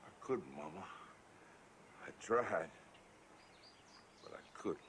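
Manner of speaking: soft, breaking into a whisper; choked up. Acted. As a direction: confess it, barely holding together. Confessing a suicide attempt.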